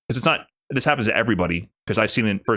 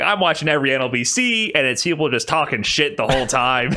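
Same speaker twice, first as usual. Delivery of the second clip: usual speaking voice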